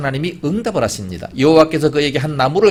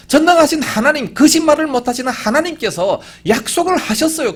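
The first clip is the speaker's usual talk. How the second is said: high, raised preacher's voice; fervent preaching; 8 semitones higher, 4 dB louder than usual. Acted. Preacher declares God's promise to answer prayer, fervent preaching. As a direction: proclaim it with fervor.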